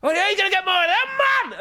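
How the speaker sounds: fast, high-pitched Geordie yelling; screaming Geordie bloke. Retold or imitated. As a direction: bellow it as a rowdy Geordie impression. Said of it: "then he screamed again".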